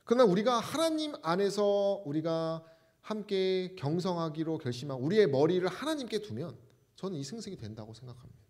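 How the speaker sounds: ordinary talk, nothing marked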